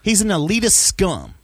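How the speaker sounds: worked up. Real, not acted. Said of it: "Sorry, I'm worked up".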